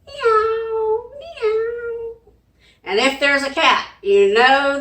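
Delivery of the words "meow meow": high, thin meowing; high cat meows for the kids. Shown as a demonstration. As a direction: mew like a kitten. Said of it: "make your cat sound".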